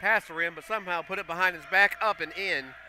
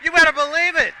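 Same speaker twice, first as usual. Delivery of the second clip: much higher and much louder, at full cry; excited shout; 12 semitones higher, 12 dB louder than usual. Real, not acted. Announcer calling the put-back and foul for listeners, thrilled shout. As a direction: scream it over the crowd.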